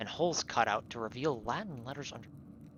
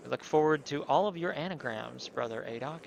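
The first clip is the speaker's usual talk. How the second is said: ordinary talk, nothing marked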